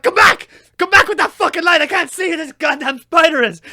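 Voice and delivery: high, loud, desperate yell; frantic shouting. Retold or imitated. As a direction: call out after someone running off with the light. Yelling after fleeing partner in dark.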